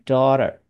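American "daughter", middle T turned into a light tap; American flapped T. Shown as a demonstration. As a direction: say "daughter" with the T softened to a quick D-like flap. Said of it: "可是美国人是念daughter". (美式变音的 daughter)

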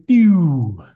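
short loud "pew" for the lights going out; mock power-out zap; sound effect for a power cut. Shown as a demonstration.

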